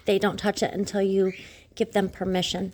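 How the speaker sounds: ordinary talk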